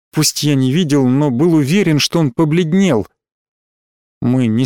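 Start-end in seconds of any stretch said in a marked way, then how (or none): none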